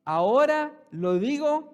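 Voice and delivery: preacher booming Paul's words at the congregation; Paul's declaration. Retold or imitated. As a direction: proclaim it loud and slow. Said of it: "Y aún que dice".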